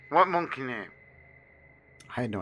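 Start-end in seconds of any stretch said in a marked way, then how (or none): 0.1-0.9 put-on higher, louder, odd garbled kid voice; strange incomprehensible voice. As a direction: mangle it like a strange child. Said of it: "strange, incomprehensible voice".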